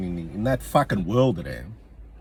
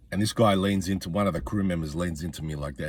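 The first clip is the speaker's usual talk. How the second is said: ordinary talk, nothing marked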